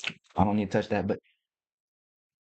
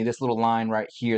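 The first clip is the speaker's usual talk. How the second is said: usual speaking voice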